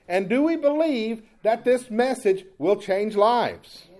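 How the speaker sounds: full-force preaching. Acted. Pastor preaching Paul's message to his church, full force. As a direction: drive the gospel message home to the congregation.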